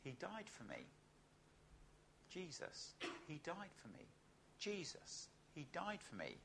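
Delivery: murmured very softly under the breath; under your breath. Shown as a demonstration. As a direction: barely voice it, to yourself. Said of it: "could you say under your breath".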